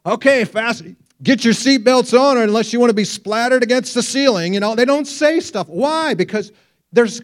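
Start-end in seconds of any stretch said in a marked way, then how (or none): 0.1-4.5 high, loud, alarmed rush; mock a pilot freaking out; panicky pilot. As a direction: pilot losing his cool on the intercom, hammed up. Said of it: "you never hear a pilot say".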